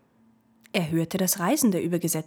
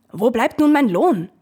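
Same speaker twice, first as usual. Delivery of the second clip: much louder demanding call; ferryman calling out; 5 semitones higher, 9 dB louder than usual. Acted. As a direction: shout after the wisps for his pay. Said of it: "Und der Alte rief".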